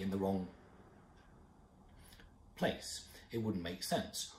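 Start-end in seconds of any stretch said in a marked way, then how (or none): 0.0-3.0 long abrupt pause after "wrong", then "place"; "wrong", a long awkward silence, "place"; sudden mid-phrase stop. Shown as a demonstration. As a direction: break off dead at "wrong", wait, then finish with "place". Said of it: "If I were to stop suddenly".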